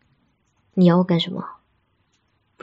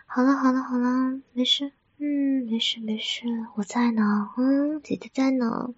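soft, higher-pitched soothing murmur; tender comforting; 3 semitones higher, 3 dB quieter than usual. Acted. Older girlfriend to crying partner at night, tender comforting. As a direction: reassure them you are right here.

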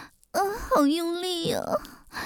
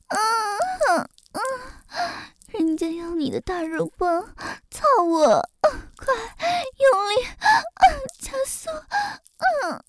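rising moans; 7 semitones higher than usual. Acted. Escalating moans in roleplay. (高亢的呻吟)

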